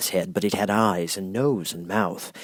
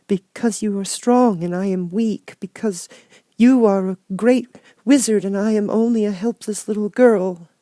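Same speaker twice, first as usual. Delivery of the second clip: put-on much higher small girl's voice; a meek little girl finding courage; little Dorothy; 13 semitones higher, 5 dB louder than usual. Dorothy pleads.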